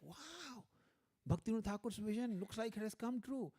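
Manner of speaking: high-pitched wow of astonishment; shocked amazement. Retold or imitated. Scholar seeing the vision come true, amazed. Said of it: "So he had a shock".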